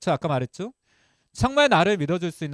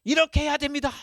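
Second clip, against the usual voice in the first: high-pitched, mock lecturing voice; hammed-up didactic command; preachy instructor; 10 semitones higher, 3 dB louder than usual. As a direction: lay down the rule like a strict teacher. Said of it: "이렇게 가르친 거잖아요".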